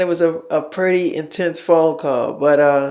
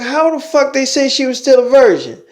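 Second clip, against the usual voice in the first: higher, indignant retold disbelief; imitating Demi's outrage, pitched up; 8 semitones higher than usual. Imitating an outraged friend on the phone, pitched-up disbelief.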